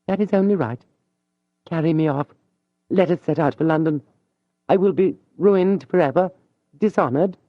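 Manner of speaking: a lady throwing away her honour; impassioned young woman. Acted. Mathilde begging Julien to carry her off to London. Mathilde voiced offering to elope.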